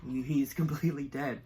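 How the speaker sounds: subdued, halting grief; real sadness, kept in; holding back tears. Real, not acted. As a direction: say it low, close to tears. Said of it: "I'm trying not to cry here".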